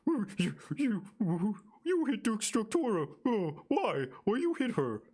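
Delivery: high, whiny little dog voice. Acted. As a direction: talk like an upset puppy. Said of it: "kinda be like".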